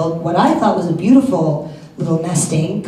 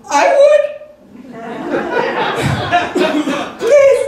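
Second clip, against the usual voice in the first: squeaky plea. Retold or imitated. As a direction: squeak out the answer desperately. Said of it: "And I go".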